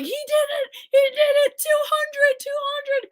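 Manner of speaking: high, excited re-enacted yell; retold yelling. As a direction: relive the goal cheer for grandpa. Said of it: "I was yelling at my grandpa".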